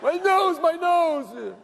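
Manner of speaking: high, drawn-out wail; hammed-up cry of pain; mock crying. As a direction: imitate a pathetic crybaby whining in pain. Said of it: "you should have heard him cry".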